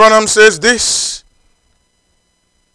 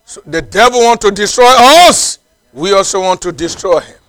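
fiery preaching; 2 semitones higher, 7 dB louder than usual. Acted. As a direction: preach it to the whole church at full force. Preacher exhorting the congregation.

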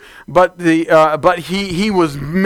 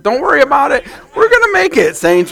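shouted encouragement with a laugh; rallying shout; 7 semitones higher than usual. Acted. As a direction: fire up the saints. Pastor rallies the congregation.

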